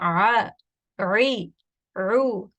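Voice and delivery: a, ri, ru tight from the middle throat; ain vowel drill. Shown as a demonstration. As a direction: squeeze a, ri, ru. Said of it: "So again, you want".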